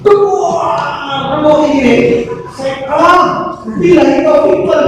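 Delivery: high, startled cry of dismay; a father caught off guard by his toddler, mock dismay; mock shocked outburst. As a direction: throw up a sudden high wail at the child. Shocked father seeing TV pushed.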